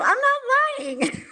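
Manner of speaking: high-pitched retold protest of innocence; indignant protest. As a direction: push the pitch way up, insisting you're honest. Acted protest to accusation of lying.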